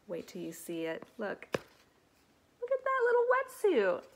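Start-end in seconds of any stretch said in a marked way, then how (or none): 1.5-4.0 very high, loud gushing over something cute; high squealing delight at a cute picture. Real, not acted. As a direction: coo over the tiny wetsuit.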